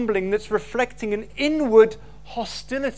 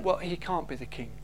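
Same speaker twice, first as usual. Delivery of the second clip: dismissive verdict; 6 semitones lower, 8 dB quieter than usual. Retold or imitated. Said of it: "they go".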